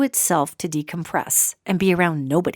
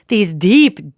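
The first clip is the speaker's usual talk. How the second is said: usual speaking voice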